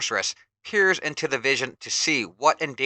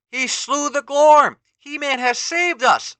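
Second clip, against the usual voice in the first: much higher, louder, slow joyful villager voice; cheering villager; 13 semitones higher, 9 dB louder than usual. Acted. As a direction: shout the good news to the crowd. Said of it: "announces one villager".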